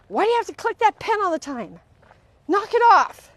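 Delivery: annoyed outburst over a clicking pen; irritated snapping. Shown as a demonstration. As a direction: yell at them to knock it off. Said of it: "That is judgment and criticism".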